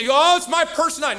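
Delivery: high, loud whiny mock; mocking excuse. Preacher to the congregation, mocking a whiny excuse in a high loud voice. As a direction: sneer out the lame excuse.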